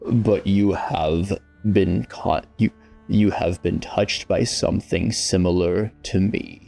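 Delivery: deep demonic patron speaking in the head; low gravelly patron voice. Acted. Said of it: "I need more grit to the voice".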